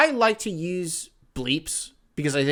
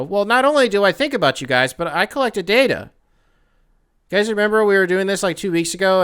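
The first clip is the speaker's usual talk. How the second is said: louder, higher, hyped announcement; excited; 8 semitones higher, 8 dB louder than usual. Real, not acted. Host hyping up the listener census results, loud and excited. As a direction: build up the reveal with energy.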